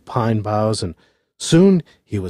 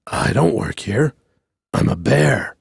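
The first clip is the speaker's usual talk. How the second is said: mild-mannered bear; 2 semitones lower than usual. A narrator's reading. Bear replies to the foreman.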